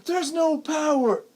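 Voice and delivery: high, slow wail imitating mom; mock panic. Son imitating mom at the window, high slow wail. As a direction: cry it out like a frantic mother. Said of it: "she goes".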